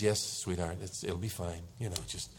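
low, soft reassurance; soothing wife. Retold or imitated. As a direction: imitate a wife quietly placating her husband. Wife soothing a ranting husband.

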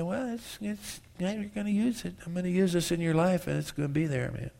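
a hint of a laid-back God; God's answer. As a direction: answer gently from above. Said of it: "he had Paul pray three times and say".

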